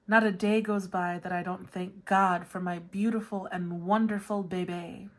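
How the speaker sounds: usual speaking voice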